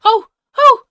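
excited high squirrel chatter building to panic; squirrel character. Acted. Player voices the squirrel hoping for a photograph, excited. Plays the squirrel spotting people, falling.

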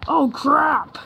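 imitating own cracked yelp; mock voice crack. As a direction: replay the crack, high and exaggerated. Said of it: "What was that voice crack right there?".

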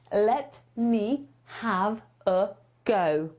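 慢速清晰地示范 Let me have a go; slow teaching model. Shown as a demonstration. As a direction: Let me have a go drawn out slowly and clearly. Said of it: "to powiesz".

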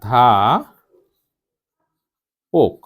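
ধা long, পোক with a closed o; teacher dictating ধা ... পোক piece by piece; syllable-by-syllable demo. Shown as a demonstration. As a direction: sound out ধা, then পোক, separately. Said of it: "আমরা তো অধ্যাপক বলি না".

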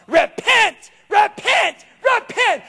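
high, loud mock shouting of repent; hammed-up fire-and-brimstone cry; mock street preacher shout. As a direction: parody a street-corner preacher yelling.

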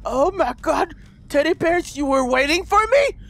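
excited gushing. Pretend. Player thrilled the teddy bears were waiting, excited gushing. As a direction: gush with mounting delight.

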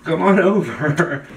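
normal talking voice

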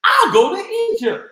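high, loud and fast with excitement; excited exclamation. Acted. Journey announces her next adventure, high and excited. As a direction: burst out thrilled about the trip. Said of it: "Journey exclaimed in excitement".

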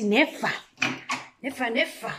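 normal talking voice

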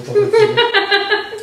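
very high, slow, loud, laughing squeal; squeaky high voice. Pretend. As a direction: stretch it out in a silly squeak. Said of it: "repeats line in put-on voice".

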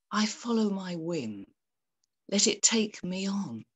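normal talking voice

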